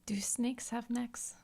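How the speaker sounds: slow puzzled whisper. Real, not acted. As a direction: whisper the question aside. Whispered aside question.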